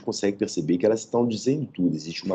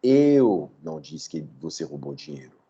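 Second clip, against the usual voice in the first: "Eu" hit hard, pitched down, rest even; stress on EU; 5 semitones lower than usual. Shown as a demonstration. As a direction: lean on "Eu" to say someone else said it. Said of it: "aí muda o sentido para quem diz".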